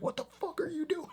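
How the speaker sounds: very high, strained disbelief; imitating outraged staff. Everyone around the doctor, a very high shocked squeal. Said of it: "everyone around him is like".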